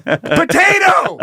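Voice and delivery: high, slow, drawn-out yell; mock shouting over noise. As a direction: bellow the word slowly across a club.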